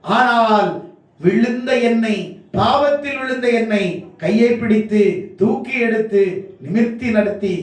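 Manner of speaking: high, sustained shouted declamation; full-force preaching. Real, not acted. Preacher testifies to being lifted out of sin, full-force preaching. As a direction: pour it out on one high pitch, building relentlessly.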